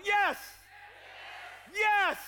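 high, very loud shouted yes; rallying shout. Real, not acted. Preacher leading the congregation in a shout, rallying cry. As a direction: shout it out for the crowd to follow. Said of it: "Everybody shout".

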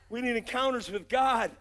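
usual speaking voice

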